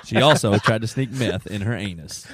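deadpan newsreader. Acted. Rereading the punchline while friend cracks up, deadpan. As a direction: read it straight-faced as a sober report.

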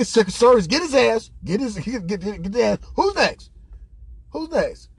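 high, worked-up mock command; fired up. Comic imitating the president summoning Secret Service, fired up. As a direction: call out orders, pitched high and pissed off. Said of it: "he was fired up".

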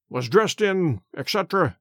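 ordinary delivery, nothing marked

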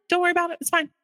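put-on high-pitched brush-off to a child; retold hasty reassurance. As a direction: imitate a parent deflecting a question. Brushing off child's awkward question.

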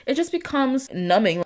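usual speaking voice

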